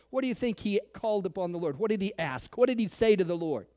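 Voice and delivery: usual speaking voice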